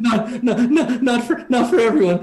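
high, stuttering soldier; frightened stammer. Acted. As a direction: stammer it back in a scared high voice. Shaken soldier stammers back to captor.

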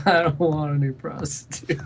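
mock quiet sobbing. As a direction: whimper it through a pretend sob. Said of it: "quietly sobbing to himself".

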